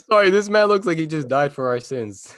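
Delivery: words breaking into laughter; laughing. Real, not acted. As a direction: talk through helpless giggles. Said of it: "Bro, why are you laughing?".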